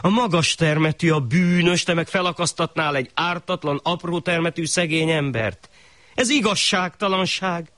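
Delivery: the poor man arguing for his life; mild earnest plea; pleading poor man. Acted. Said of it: "rimánkodott a szerencsétlen".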